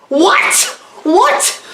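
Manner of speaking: high, rising incredulous "what?"; mock astonishment. Reviewer reacting to the duck twist, mock astonished. Feigned shock at film's twist.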